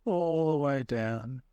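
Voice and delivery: guided meditation. Acted. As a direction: ease them into the breath, unhurried. Leads a guided breathing meditation.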